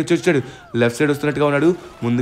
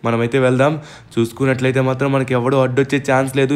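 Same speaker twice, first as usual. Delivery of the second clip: usual speaking voice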